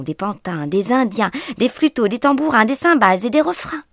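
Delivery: loud, fast, high carnival rush; breathless festive storytelling, loud and quick; excited narration. A narrator's reading. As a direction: sweep them up in the whirling dance.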